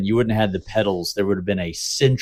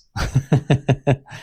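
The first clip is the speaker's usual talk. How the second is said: amused burst of laughter; 4 semitones higher than usual. Real, not acted. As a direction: laugh along at a co-host's rant. Laughs at co-host's rant.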